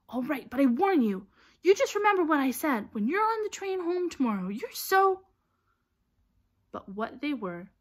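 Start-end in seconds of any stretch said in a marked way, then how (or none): 0.1-5.3 high, loud scolding; heated, high-pitched telling-off; indignant warning. A narrator's reading. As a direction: warn them off in a huff. Hermione's indignant warning, cut off.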